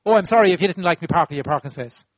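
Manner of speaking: hurried mock apology that shifts the blame; wrong-way apology. Shown as a demonstration. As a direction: toss off a brisk, breezy sorry.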